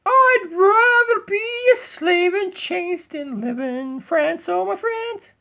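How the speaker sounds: slow, high, loud crying shout; hammed-up sob-shout of a slave; dramatic wail. Doggy bursts in with a hammed-up crying shout. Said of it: "What a dramatic entrance".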